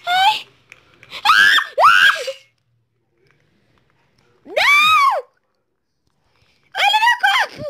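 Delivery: high screams of outrage; raging gamer. Real, not acted. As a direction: scream as you get killed. Getting killed in game.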